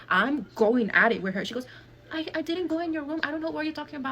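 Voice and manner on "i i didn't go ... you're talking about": high-pitched imitated denial; mock defensive cousin. Cousin denies going into the room, mock defensive. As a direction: put on a high voice of feigned innocence.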